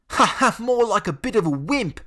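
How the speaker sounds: high, loud mocking laugh; schoolboy taunt; jeering bully. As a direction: sneer and laugh at the wimp. Jordan jeering.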